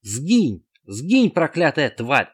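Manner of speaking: loud, slow shouted curse; yelling. A narrator's reading. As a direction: yell in terror. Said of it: "орал Эд".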